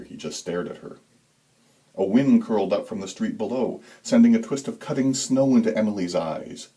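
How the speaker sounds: plain reading voice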